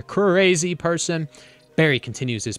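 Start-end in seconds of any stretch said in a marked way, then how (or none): 0.0-1.3 slow, loud, higher "Crazy person"; drawn-out angry yell at the bee. Acted. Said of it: "One driver yells at the manager".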